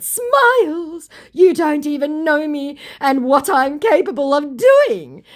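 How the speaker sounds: very high, loud, silly boast; hammed-up empty threat; mock threatening scammer. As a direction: squeal the threat to make it ridiculous. Scammer's menacing message, acted mockingly.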